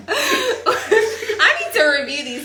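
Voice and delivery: high-pitched, drawn-out laughing complaint; laughing protest. Real, not acted. Losing player objects to the quiz questions, laughing. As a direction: protest through real laughter, pitched well up. Cracking up over failed quiz answers.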